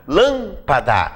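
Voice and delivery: lâmpada said slowly, LÂM held long, then pa-da; stress on LÂM. Shown as a demonstration. Teacher showing the tonic syllable, stress on LÂM. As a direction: stretch the first syllable of lâmpada. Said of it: "A sílaba tônica é o lã".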